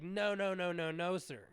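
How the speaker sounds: loud protest; loud insistent protest. Retold or imitated. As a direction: shut him down firmly. Arguing with the line cutter.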